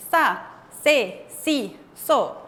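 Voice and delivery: "Sa, se, si, so" with a strong, stressed s; S más fuerte. Shown as a demonstration. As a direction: separate syllables "Sa, se, si, so", each as if accented. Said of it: "pero más fuerte". ("Sa, se, si, so" con S fuerte, como con tilde)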